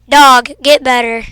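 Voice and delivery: ordinary delivery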